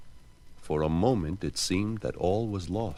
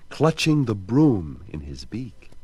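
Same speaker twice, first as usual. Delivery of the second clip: plain reading voice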